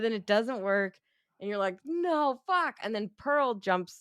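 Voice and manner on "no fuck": high-pitched cry of dismay; high, let-down exclamation; dismayed outburst. Retold or imitated. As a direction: act out a viewer's exasperated dismay. Voicing viewer's dismay at failed gun.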